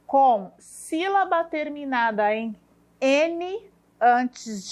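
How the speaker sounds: drawn-out, spaced dictation; slow dictation. Shown as a demonstration. Teacher dictating the exercise to the class, slow. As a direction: spell out the rule slowly for children. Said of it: "teacher dictates the task slowly".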